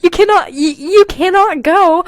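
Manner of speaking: high, loud pleading cry. Acted. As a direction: beg her not to leave. Acted pleading line.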